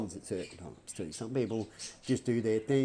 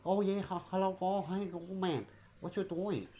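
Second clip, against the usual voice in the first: mock Chinese accent; 6 semitones higher than usual. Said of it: "if I talk like you with an accent".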